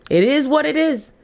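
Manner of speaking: lifted resigned declaration. Real, not acted. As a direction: say it up high, like a shrug to the crowd. Repeats the phrase twice.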